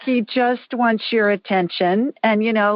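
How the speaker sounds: ordinary talk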